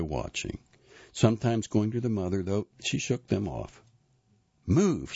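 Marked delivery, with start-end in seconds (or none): 4.7-5.1 short, high raised-voice command; yelled order. A narrator's reading. Mother shaking off her children, yelled order. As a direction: yell it sharply at a child. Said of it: "she yelled at one of them".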